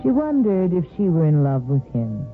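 normal talking voice

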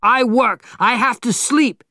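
high, loud, exasperated outburst; angry high-pitched yell; shouting. A narrator's reading. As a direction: shout it at a noisy neighbour. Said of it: "Mister Yuanashi shouted".

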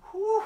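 a high, loud relieved whew. Real, not acted. Set finished.

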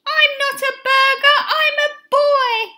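very high, loud protest; shout. A narrator's reading. Benny to the man selling him, very high shout. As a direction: shout it out at the top of the range. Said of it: "shouted Benny".

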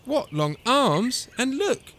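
high-pitched fussy complaint; fault-finding mother, voice rising; grumbling. A narrator's reading. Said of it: "And she grumbled".

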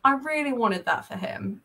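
normal talking voice

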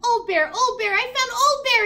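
Little Bear finds his lost friend, ecstatic and loud; excited cry. Acted. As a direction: shout it out like a thrilled child. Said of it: "jumped up and down with excitement".

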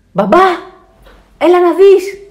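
high, loud, urgent call; child shouting. A narrator's reading. Alfons calls Dad to come see, shouting. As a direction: summon Dad at full voice. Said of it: "φωνάζει ο Άλφονς".

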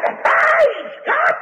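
high crying shout of praise, voice cracking; joyful shout. Retold or imitated. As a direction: cry it out to heaven, overjoyed. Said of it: "that old mother shouted on the other end".

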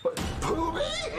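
shocked cry. Acted. Finding Poobie tied in closet.

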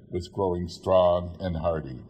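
usual speaking voice

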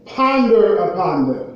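usual speaking voice

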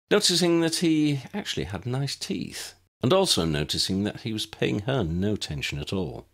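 ordinary delivery

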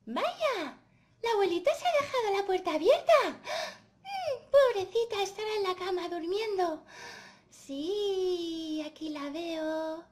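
put-on high little-girl puppet voice; cheery Caperucita. Caperucita finding grandma's door open, cheery and high. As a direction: voice a trusting girl, high and lively.

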